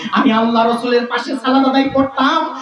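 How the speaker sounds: high, chanting sermon lament; impassioned preaching. Acted. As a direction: voice climbing to a very high cry. Preaches Kab's longing at full force.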